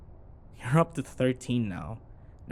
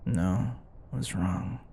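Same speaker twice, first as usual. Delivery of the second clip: ordinary delivery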